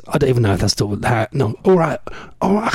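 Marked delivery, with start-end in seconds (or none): 1.6-2.7 put-on hesitant stab at a Geordie accent; attempted Geordie accent. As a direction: try out a Geordie greeting, badly. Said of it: "I can't do his accent".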